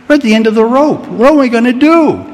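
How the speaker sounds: like someone at the end of their rope; desperate plea. Acted.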